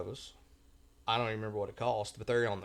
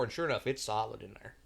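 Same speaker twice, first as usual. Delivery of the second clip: usual speaking voice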